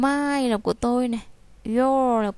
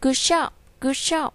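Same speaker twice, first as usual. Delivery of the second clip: praising tone; 3 semitones higher, 4 dB louder, slower than usual. Shown as a demonstration. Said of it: "mình sẽ khen là".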